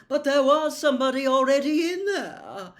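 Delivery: a prophetess wronged and cross; indignant seer. A narrator's reading. Trelawney telling Harry about the intruder, indignant. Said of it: "glaring at the wall".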